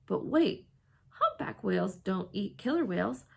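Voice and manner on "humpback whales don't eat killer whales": usual speaking voice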